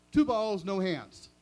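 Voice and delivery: loud punchline delivery; punchline blurt. Shown as a demonstration. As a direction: bark out "two balls" as the gag. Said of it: "you ready?".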